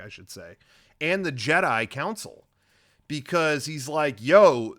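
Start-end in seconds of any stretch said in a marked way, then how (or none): 4.2-4.8 sudden loud yo. Retold or imitated. Dooku confronting the council.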